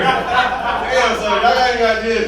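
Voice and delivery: ordinary talk, nothing marked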